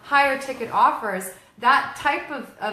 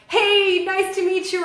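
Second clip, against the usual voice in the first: mock hyped hello, much higher and louder; loud fake-friendly salesman hello; pushy networker greeting; 8 semitones higher, 7 dB louder than usual. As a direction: ham up a too-eager stranger.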